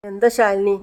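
ordinary delivery

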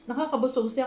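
words around a bite of food; speaking between mouthfuls; talking while chewing. Real, not acted. Seen 2: Holds fork over plate, mouth working as if chewing.

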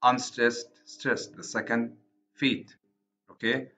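ordinary delivery

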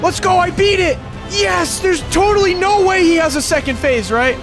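high excited shouting, celebrating; victory cheering. Real, not acted. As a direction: cheer the win at full voice. Just beat Elden Ring.

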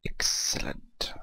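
drawn-out, whispered relish; whispered gloat. Pretend. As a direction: whisper it slowly, savouring the word. Gloating over lava trick working.

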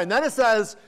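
ordinary delivery

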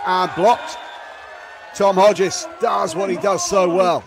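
loud shout of the block; excited shout. Real, not acted. Commentator celebrating Tom Hodges' block, shouting. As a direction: roar it out. Big block called live.

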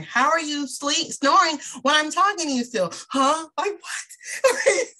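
mock exasperated wake-up call; incredulous scolding. Scolding sleepy husband, incredulous.

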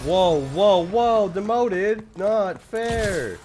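high, loud protesting yell; shocked yelling. Real, not acted. Shocked outburst at demotion.

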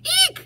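very high, loud eek; frightened shriek. Acted. Elephant spots a mouse, shrieked eek. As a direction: squeal in sudden fright. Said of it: "shouted the elephant jumping up".

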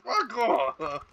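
high laughing dismissal; laughing fake rudeness; laughing brush-off. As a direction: toss it off through a laugh. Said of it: "I'm like".

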